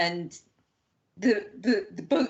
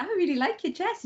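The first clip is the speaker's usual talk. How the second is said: gushing compliment; 11 semitones higher than usual. Retold or imitated. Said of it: "I was like".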